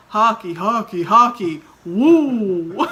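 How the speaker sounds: loud Boston dad cheering hockey; dad impression. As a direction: chant it like a proud hockey dad. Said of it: "What does my dad say?".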